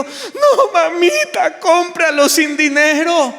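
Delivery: put-on high, whiny little girl insisting; pleading child. Daughter insisting to her mother at the mall, high whiny plea. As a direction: whine it like a child who won't give up.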